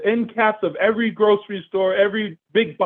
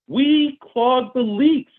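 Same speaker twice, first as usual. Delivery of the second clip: we clog the leaks drawn out slow and higher; slow emphatic slogan; 5 semitones higher, slower than usual. Real, not acted. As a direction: hammer the slogan home slowly. Slogan stressed in sales pitch.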